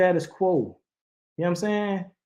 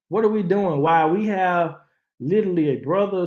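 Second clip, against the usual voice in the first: normal talking voice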